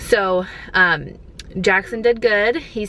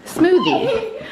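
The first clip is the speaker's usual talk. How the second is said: laughing; 9 semitones higher, slower than usual. Real, not acted. Delighted about free smoothie.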